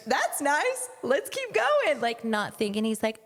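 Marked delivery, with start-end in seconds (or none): 0.1-2.0 high-pitched cheery dismissal; breezily oblivious. Retold or imitated. Rider passing the beach table on her pony, breezily oblivious. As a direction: imitate yourself blithely passing by, voice way up high.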